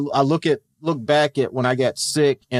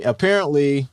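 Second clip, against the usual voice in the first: ordinary talk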